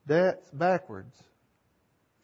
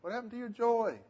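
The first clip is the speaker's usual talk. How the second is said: quoted rebuke pitched high but softer; plaintive question; 8 semitones higher, 6 dB quieter than usual. Retold or imitated. Teacher voicing Paul asking Galatians about lost joy, higher and softer. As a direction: ask it like a sad, puzzled rebuke. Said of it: "he says".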